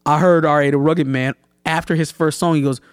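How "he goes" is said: ordinary talk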